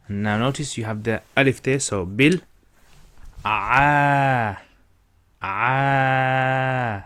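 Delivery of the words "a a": long drawn-out a vowel; stretched a. Shown as a demonstration. Teacher drawing out the a for students. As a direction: hold the a sound long. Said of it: "stretch it slightly".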